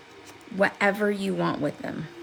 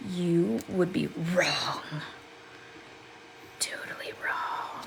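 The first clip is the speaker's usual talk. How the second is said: slow, breathy stage whisper of a secret; sly whispered tease to the viewers; teasing whisper; 8 dB quieter, slower than usual. Pretend. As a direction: lean in and whisper it playfully.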